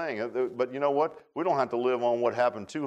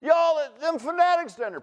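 high, loud scoffing voice of the crowd; mocking neighbor's jeer, pitched up and loud; mocking townsfolk; 12 semitones higher, 4 dB louder than usual. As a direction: sneer at the fanatics from the road.

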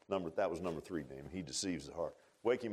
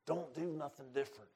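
hushed, coaxing tempter; a hint of a sly devil whispering; Satan's soothing lie; 9 dB quieter than usual. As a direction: lull them softly into comfort. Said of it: "Satan tells people that sits on church pews".

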